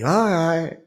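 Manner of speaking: high-pitched mocking imitation; mimicked parting remark. Man walking away after outburst, high mimicry.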